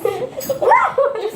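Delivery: high-pitched burst of giggles; real squealing laugh; high laughter. Real, not acted. Wet dog, Nick teasing her.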